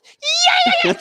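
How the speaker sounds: very high, loud revving screech; imitated engine roar. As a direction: rev it like a car flipping out. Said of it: "So the car goes".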